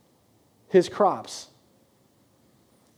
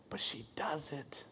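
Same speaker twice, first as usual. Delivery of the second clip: whisper; 21 dB quieter than usual. A narrator's reading. Dramatic hushed narration beat.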